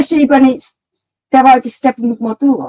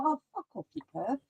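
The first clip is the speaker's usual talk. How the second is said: much softer dismissive retort; muttered brush-off; 19 dB quieter, faster than usual. Retold or imitated. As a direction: toss it off under your breath. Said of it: "I'm just like".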